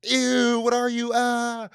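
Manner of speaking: high, loud squeal of disgust; hammed-up scream of revulsion; horrified mock shriek. Horrified son seeing the ugly monster, mock shriek.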